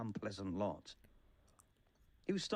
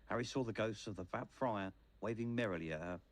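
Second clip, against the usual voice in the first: usual speaking voice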